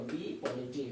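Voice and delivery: ordinary talk, nothing marked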